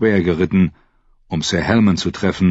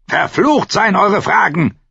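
high, loud defiant shout; furious curse; 13 semitones higher, 4 dB louder than usual. A narrator's reading. Captive Benfred curses Theon's questions, shouting angrily. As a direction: spit out the curse at full voice.

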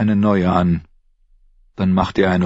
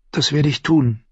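lightly voiced woman, higher pitch; promising woman; 7 semitones higher than usual. A narrator's reading. Shay promising to wait, higher woman's voice. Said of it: "versprach sie".

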